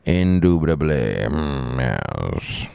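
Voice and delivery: mock Southern drawl falling deep on the last word; Southern accent. Doing it to confuse the old lady, mock Southern. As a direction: put on a savoring Southern drawl. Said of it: "I'm gonna do that with the old lady".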